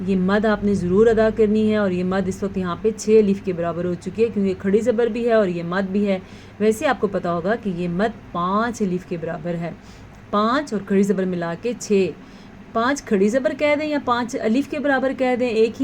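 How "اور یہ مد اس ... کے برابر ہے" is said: ordinary talk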